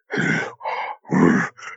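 unvoiced imitation of a wheezing, asthmatic breath; heavy breathing. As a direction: push out a hard, winded breath like a big animal. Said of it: "It was going like".

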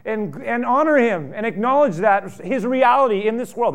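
loud, high, ringing pulpit cry; preaching at full force. Acted. Pastor urging the congregation to shine, in a loud ringing preach. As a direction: preach it out to the whole room.

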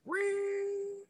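very high, thin squeal of a ringing ear; piercing ring imitation; imitated ear ring.

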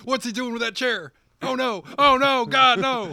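high, loud ringside panic; excited commentator. Pretend. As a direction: shout it like a stunned commentator. Mock wrestling commentary.

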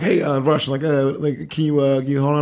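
nervous stop-and-start with fillers; unconfident phone manner. Retold or imitated. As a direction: imitate someone fumbling through a sales call. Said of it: "you can tell he's kinda unconfident".

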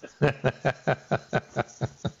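honking duck call run; duck call honks. Shown as a demonstration. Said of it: "maybe not like that".

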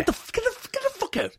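very high squealed yell; mock screamed order. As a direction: shriek him off the court. Mock referee shouting.

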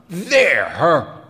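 loud, harsh burst; savagely. A narrator's reading. Bandleader tossing the boy's boots away, savage and loud. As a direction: spit it out savagely. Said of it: "said Helmholtz savagely".

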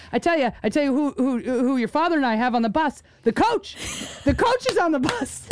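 worked-up mother, pitch climbing high; mom going off. Retold or imitated. Daughter imitating her mom blaming the coach, going off. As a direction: imitate an indignant mom building to a punchline. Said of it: "she went off".

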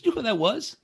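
high, urgent mock question; excited crewmate. Crewmate tells him who he just talked to, high and urgent. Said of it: "He goes".

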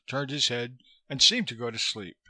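ordinary delivery, nothing marked

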